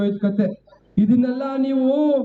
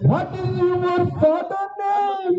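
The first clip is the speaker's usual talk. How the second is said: high, long drawn-out question; showy, slow, high-pitched quizmaster; theatrical quizzing; 6 semitones higher, much slower than usual. Acted. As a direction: stretch each word out, pitched well up. Quiz host repeats question with mounting exasperation.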